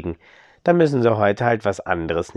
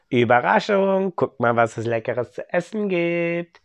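calling out; 7 semitones higher than usual. Acted. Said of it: "hat zu allen gerufen".

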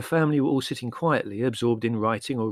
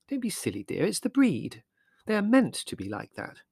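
Mother, mild reproof; 9 semitones higher than usual. A narrator's reading. Mother replying to Larry.

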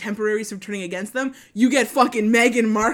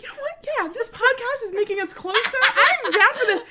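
high, bubbly excitement; high excited; 12 semitones higher than usual. Retold or imitated. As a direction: gush like it's a big moment. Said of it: "I had a moment where I was like".

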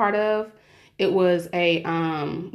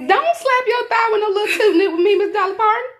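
high, loud mock Southern twang; country twang; 13 semitones higher, 5 dB louder than usual. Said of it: "I have a country accent".

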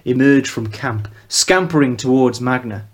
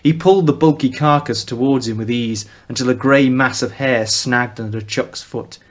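plain reading voice

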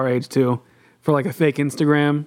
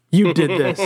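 mock angry accusation; 3 semitones higher than usual. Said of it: "they're getting so mad".

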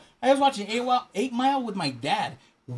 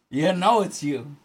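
ordinary delivery

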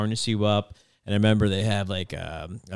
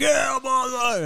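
high, slow whooping shout; triumphant yell; 3 dB louder, much slower than usual. Retold or imitated. Triumphant yell at end of jump.

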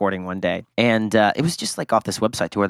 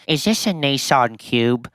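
slow British question, pitched up; British accent; 4 semitones higher, slower than usual. Retold or imitated. Valet mimics rich guest pointing at a Prius, British accent. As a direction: imitate a British hotel guest. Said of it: "He's this British guy".

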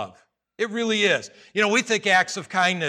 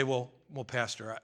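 mock reluctance; 7 semitones lower, 12 dB quieter than usual. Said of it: "You say".

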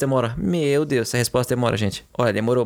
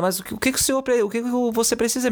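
lightly voiced lady, pitched higher; concerned older lady; 8 semitones higher than usual. Lady at the embassy asking what he needs, lightly imitated concern. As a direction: lift the voice as a kindly bystander, settling at the end. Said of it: "Aí uma senhora do lado".

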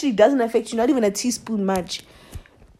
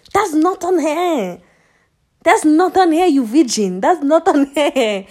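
higher, emphatic, drawn-out telling-off; indignant scolding; 5 semitones higher than usual. Acted. Berating salty men over women, raised indignant scolding. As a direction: scold with raised pitch, stretching the words.

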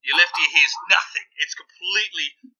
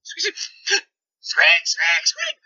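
rat squeaks; 2 semitones higher, 3 dB louder, much slower than usual. Acted. Game master squeaks as the rats in the corner.

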